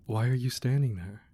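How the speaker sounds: ordinary delivery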